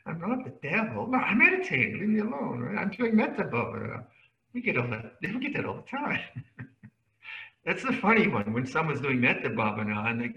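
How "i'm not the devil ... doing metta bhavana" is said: higher, indignant mock protest; a lightly hammed-up monk insisting he is meditating; mock precious monk. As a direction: ham up a touchy monk defending his practice. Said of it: "monks get that way sometimes".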